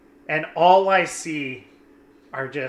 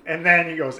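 usual speaking voice

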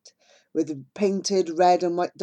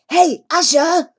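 loud, high, drawn-out call; excited call; 10 semitones higher, 9 dB louder, slower than usual. A narrator's reading. Jivan calling Asha from the elephant, loud and excited. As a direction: shout down from the elephant. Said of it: "calls Jivan, excitement dancing in his eyes".

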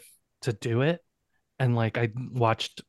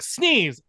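imitated sneeze said as a word, higher; mock sneeze; 7 semitones higher than usual. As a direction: fake a small sneeze. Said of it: "it's just like".

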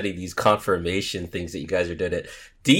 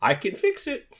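much higher cheerful cartoon builder voice; sing-song, very high jolly builder; Bob the Builder; slower than usual. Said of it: "should we call him Bob the Builder?".

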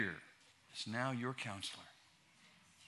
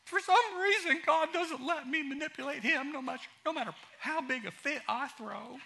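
lightly mock sulking; 13 semitones higher, 7 dB louder than usual. Continues mocking self-pity.

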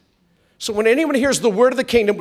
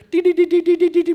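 high, rapid monotone beeping dee-dee-dee; imitated pulsing signal; 10 semitones higher, 4 dB louder, faster than usual. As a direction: rattle it off like an incoming signal. Imitates incoming pulsation signal.

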